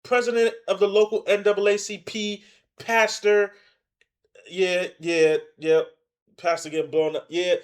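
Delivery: high, loud heckling proclamation; mock hype. Loudly mocking the pastor's titles.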